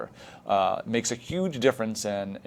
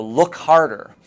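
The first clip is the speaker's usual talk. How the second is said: urging teacher; 4 semitones higher, 6 dB louder, slower than usual. Shown as a demonstration. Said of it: "or, you know".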